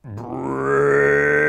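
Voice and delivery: drawn-out groaning brains; zombie moan. Pretend. Zombie-themed coupon code.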